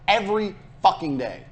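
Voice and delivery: ordinary talk, nothing marked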